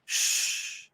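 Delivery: a quick whispered shush; hushing. Real, not acted. Hushing after co-host laughs.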